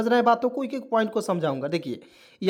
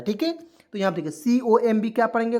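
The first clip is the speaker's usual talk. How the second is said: ordinary talk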